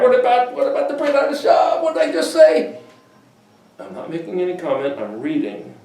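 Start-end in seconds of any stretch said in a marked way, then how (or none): 0.0-2.8 mock objector. Imagined objector, then retort.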